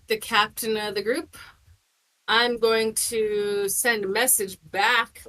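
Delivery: normal talking voice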